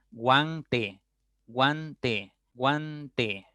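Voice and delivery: "guante" in two stretched syllables, guan-te; slow pronunciation demo. Shown as a demonstration. Teacher drilling the U-A diphthong, "guante" drawn out. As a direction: repeat "guante" slowly, opening on the A. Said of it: "cuando pronuncias la A".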